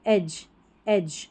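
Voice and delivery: "एज" ending in a j sound; slow model reading of "एज", dge said as ज. Shown as a demonstration. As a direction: say "एज" slowly as ej. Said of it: "डी जी ई को ज पढ़ेंगे".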